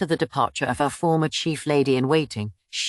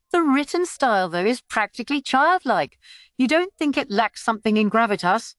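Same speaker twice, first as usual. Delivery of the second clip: higher, chatty court doctor; a fussy quack teasing, pitched up; 7 semitones higher than usual. A narrator's reading. Quack doctor teasing father about his handwriting, high voice. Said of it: "The quack continued with a chuckle".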